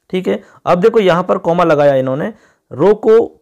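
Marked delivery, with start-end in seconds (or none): 2.7-3.4 रोको pitched up and louder, then a stop; रोको as a command on its own; रोको set apart. Shown as a demonstration. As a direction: hit रोको and halt as at a comma. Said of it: "फिर comma".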